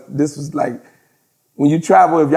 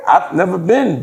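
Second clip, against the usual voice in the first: ordinary delivery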